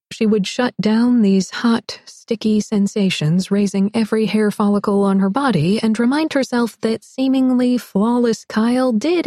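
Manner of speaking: usual speaking voice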